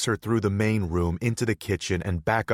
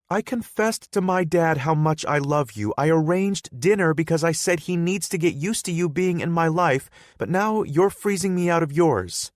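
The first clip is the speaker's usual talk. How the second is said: put-on higher, louder, upset girlfriend voice; hurt girlfriend Hannah; 11 semitones higher, 7 dB louder than usual. Hannah confronting Bodhi, higher and louder. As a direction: confront him for shutting her out.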